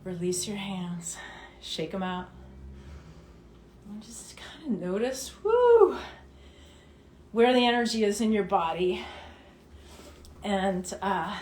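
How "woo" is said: excited whoop. Real, not acted. Energy after the exercise.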